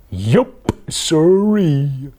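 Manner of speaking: loud cow drawl on siree; Cow's voice. Acted. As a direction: play the stubborn farm cow. Cow's catchphrase voice.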